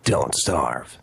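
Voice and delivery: low, gruff Snake growl; Snake's voice. Pretend. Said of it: "Can we hear you say Don't Starve in Snake's voice".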